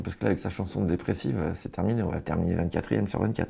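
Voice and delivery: usual speaking voice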